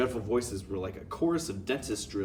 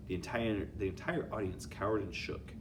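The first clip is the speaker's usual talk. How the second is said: plain reading voice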